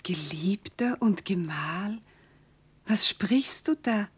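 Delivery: breathless whisper, slow and startled; hushed whisper. Acted. Alkmene to her lover, puzzled, in a whisper. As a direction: whisper it as if caught off guard.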